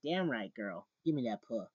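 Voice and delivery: loud, sassy read of the line; sassy girl line.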